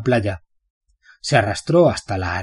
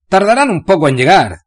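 eager reassurance; 6 semitones higher, 7 dB louder than usual. Acted. Baird reassures Bane.

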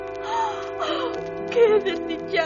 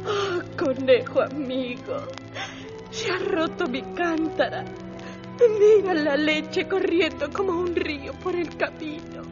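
put-on weepy girl bidding goodbye; tearful milkmaid; slower than usual. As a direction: sob through the farewells. Milkmaid weeps her losses. (lecherita llorosa)

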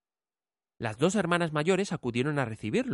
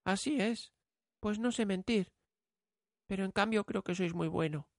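put-on high, soft, sincere girl; young girl voice; 8 semitones higher, 4 dB quieter than usual. A narrator's reading. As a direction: answer honestly and gently. Bella answers.

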